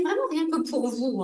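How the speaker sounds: usual speaking voice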